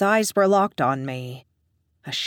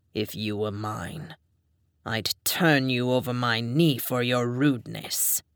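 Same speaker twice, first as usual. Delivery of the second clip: low, soft, threatening male voice; menacing dom; 5 semitones lower, 4 dB quieter than usual. A narrator's reading. Dom threatening the narrator, low and soft. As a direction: promise the punishment quietly.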